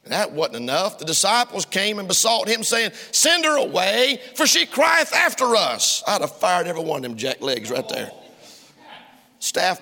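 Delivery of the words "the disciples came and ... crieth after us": full-force preaching. Acted. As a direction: draw it out from the pulpit. Preaching the disciples' words.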